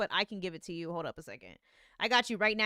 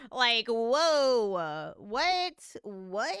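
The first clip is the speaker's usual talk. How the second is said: a rising, loud whoa of disbelief; stunned surprise; 5 dB louder, much slower than usual. Real, not acted. Streamer to the producer who just sang: loud stunned whoa. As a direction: react like you can't believe what you just heard. Reacting to the test vocal.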